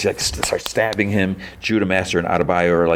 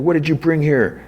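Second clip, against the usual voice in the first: anguished demand; 10 semitones higher than usual. Retold or imitated. Keith confronts over his dad.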